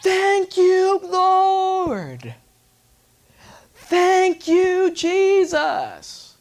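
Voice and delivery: high, loud ecstatic shouting; a newly saved man screams thanks to God, high ecstatic shouting; screaming praise. Retold or imitated. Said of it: "he's screaming".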